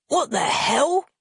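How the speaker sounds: high, indignant snap; snapping. A narrator's reading. Father reacts to an accusation, snapping. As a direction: snap back in disbelief. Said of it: "Tel snaps".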